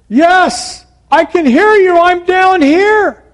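high, loud, drawn-out holler; yelling back. Acted. Jack answering the voice above him, desperate yell. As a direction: shout to be found. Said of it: "he yelled for a long time".

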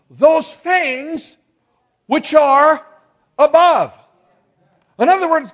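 loud, high-pitched pulpit oratory; full-force preaching. Real, not acted. Preacher urges people to seek things above, full-force preaching. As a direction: build in emphatic bursts with pauses between.

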